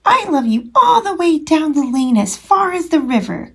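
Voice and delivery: excited cry. Acted. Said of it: "cried Little Nut Brown Hare".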